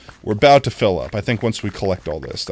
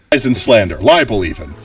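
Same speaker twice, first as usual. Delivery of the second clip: indignant shout; 8 dB louder than usual. Pretend. Mock denial repeated louder.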